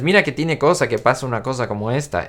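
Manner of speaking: rapid-fire Ricky-style Spanish; really fast Spanish. Retold or imitated. Fan quoting Ricky for viewers, really fast Spanish. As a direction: rattle the Spanish off in one quick run. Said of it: "It's really fast".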